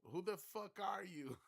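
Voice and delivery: high, loud yell breaking into laughter; mock yell, laughing. Guy yelling at man on far ship, laughing shout. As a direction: yell it while cracking up. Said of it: "He's like".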